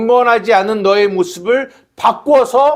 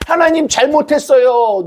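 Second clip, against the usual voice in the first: 흉내 낸 울먹이는 기도; 6 semitones higher than usual. Retold or imitated. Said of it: "눈물을 흘려요".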